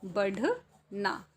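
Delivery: बढ़ना drawn out slowly; dictating बढ़ना slowly; slow dictation. Shown as a demonstration. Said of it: "ठीक है".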